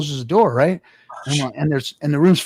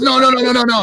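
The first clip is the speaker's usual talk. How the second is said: high, louder string of protests; imitated excited insistence; 9 semitones higher, 5 dB louder, faster than usual. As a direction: cut in urgently as the excited buddy. Excited buddy insists on phone.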